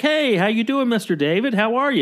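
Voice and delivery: a hint of the handyman's cheerful hello; handyman's first try. [name] coaching the handyman, first light greeting. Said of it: "Okay, so say it like that".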